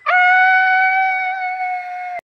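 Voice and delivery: sharp high cry out; high scream. Acted. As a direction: sumigaw nang matinis na iyak. Scream after being scolded. (matinis na sigaw)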